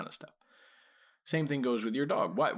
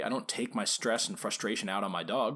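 normal talking voice

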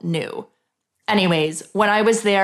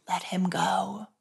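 softer, slow little voice; small inner voice; 10 dB quieter, slower than usual. Retold or imitated. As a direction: voice it like a quiet inner whisper of wisdom. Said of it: "this small voice inside of me".

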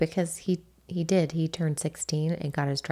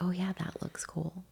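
quiet; 2 semitones higher, 7 dB quieter than usual. Retold or imitated. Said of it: "he was like".

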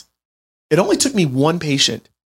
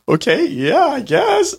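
wife's voice; 14 semitones higher, slower than usual. Retold or imitated. Wife's reluctant reply voiced.

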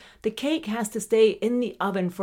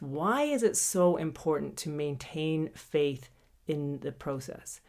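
usual speaking voice

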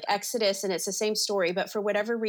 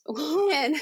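ordinary talk, nothing marked

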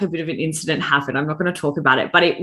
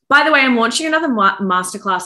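bad bitch energy; 6 semitones higher, 4 dB louder than usual. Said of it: "bad bitch energy".

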